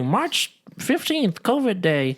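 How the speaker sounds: high, drawn-out ceremonial voice; mock proclamation. Imagining a covid memorial day, mock proclamation.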